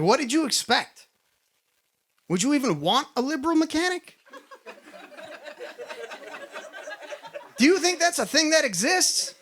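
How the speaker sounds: high-pitched mock disbelief; incredulous questioning. Mock-incredulous retort to coworker.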